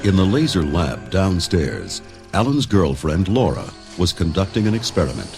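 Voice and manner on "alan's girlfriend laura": usual speaking voice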